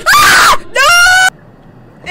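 very high, very loud shriek of fright; jump-scared player screaming no; terrified scream. Real, not acted. Jump scare in horror game.

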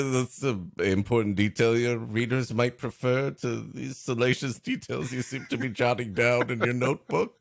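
usual speaking voice

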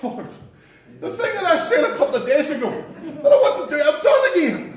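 sheepish confession. Retold or imitated. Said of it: "I'm going back and going".

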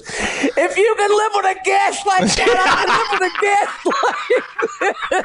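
high squawking parrot retort. Acted. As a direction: screech back like an indignant parrot. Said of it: "He says".

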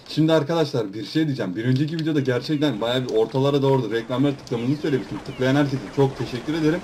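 ordinary talk

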